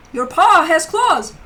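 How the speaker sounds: real ouch at a sharp cat paw; ouch, claws. Real, not acted.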